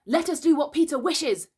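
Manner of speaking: put-on high, loud boys' shout; eager cry. A narrator's reading. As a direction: cry it out like simple, obedient boys. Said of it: "cried the simple boys".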